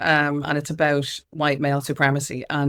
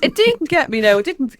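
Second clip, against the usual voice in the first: higher and louder, digging her heels in; stubborn insistence; 9 semitones higher, 6 dB louder than usual. Real, not acted. To the host, stubbornly louder about burnout. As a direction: insist it never got her. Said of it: "I'm very stubborn".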